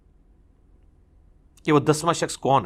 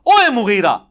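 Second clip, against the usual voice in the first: loud, slow, angry call at a higher pitch; angry rebuke; 5 semitones higher, 6 dB louder than usual. Retold or imitated. An old companion angrily rebuking the governor, slow loud shout. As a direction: call him out in retold anger. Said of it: "غصے میں کہتے ہیں".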